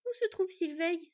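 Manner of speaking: high, slow, anxious question; worried. A narrator's reading. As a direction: ask with growing worry. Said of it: "s'inquiéta ensuite la renarde".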